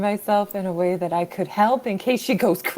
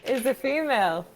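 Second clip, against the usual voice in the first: attempted British accent. Player recapping the last session for the group, attempted British accent. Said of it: "worse British accents".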